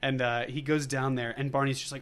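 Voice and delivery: usual speaking voice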